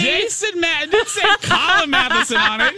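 very high, indignant protest; hammed-up defensive wailing; mock outrage. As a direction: squeal your defense, drawing out key words. Mock quarrel over who got him.

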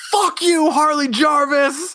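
high yelled heckle; a hammed-up high heckle; mock heckler yell. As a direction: shout it from the crowd. Heckler in crowd yelling.

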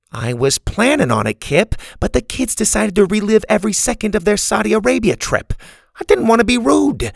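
high-pitched grandma excusing herself; grandma, defensive. Acted. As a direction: justify yourself as a put-upon grandma. Grandma figure defending herself to Kip.

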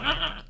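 short high cat cry, rising at the end; distressed cat yelp; imitated cat yowl. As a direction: mimic the cat pawing at her face.

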